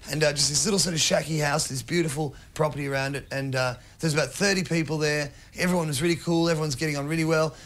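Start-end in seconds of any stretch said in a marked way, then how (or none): none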